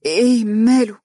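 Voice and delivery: startled and uneasy; tense, nervous. A narrator's reading. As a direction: ask in worry, voice lifted. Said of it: "وقالت عبير بتوتر".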